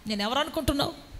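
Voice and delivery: ordinary talk, nothing marked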